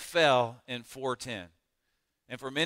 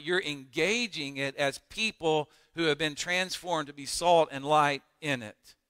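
normal talking voice

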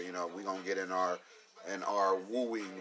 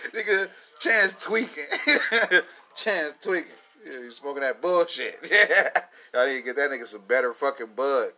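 laughing put-down; 5 semitones higher, 9 dB louder than usual. Real, not acted. Mocking Chance, laughing.

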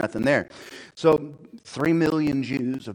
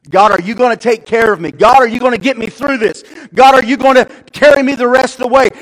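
high, forceful appeal, building louder; loud pleading prayer; 12 semitones higher, 18 dB louder than usual. Acted. Believer asking God to carry them, loud pleading. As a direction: cry out to God for help.

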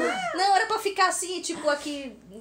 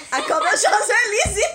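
laughing; 9 semitones higher, 8 dB louder, slower than usual. Real, not acted. Laughing, calling friend.

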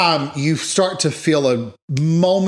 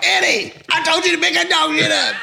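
scolding wife; 13 semitones higher than usual. Retold or imitated. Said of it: "she's gonna be like".

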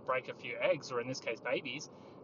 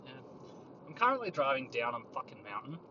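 ordinary delivery

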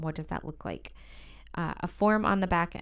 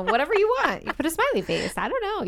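excited; 12 semitones higher, 7 dB louder, faster than usual. Real, not acted. Playful sign ideas, pitch jumps.

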